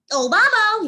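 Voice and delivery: high, loud sing-song taunt; teasing call. A narrator's reading. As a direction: call out to mock him. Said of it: "he calls".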